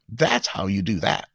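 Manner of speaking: usual speaking voice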